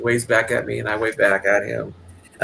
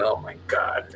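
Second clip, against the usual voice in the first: unvoiced whisper, a little flustered; embarrassed whisper; 7 dB quieter, slower than usual. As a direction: whisper it, caught blushing. Embarrassed aside at the teasing.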